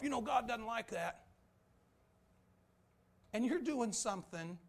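full-force preaching. Acted. Preacher rebuking the congregation, rising full-force preaching.

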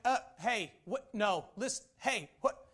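flustered interrupting. Acted. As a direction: try to break into a caller's nonstop pitch. Acts failing to interrupt a caller.

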